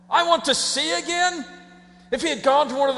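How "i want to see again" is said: high-pitched disbelieving repeat; mock astonishment at an audacious request; incredulous echo. Real, not acted. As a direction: echo it as if absurd. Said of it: "That's absurd".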